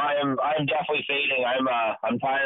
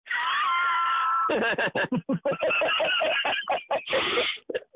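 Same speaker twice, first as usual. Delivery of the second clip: shouted laugh. Real, not acted. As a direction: let out a big laugh. Laughing at own remark.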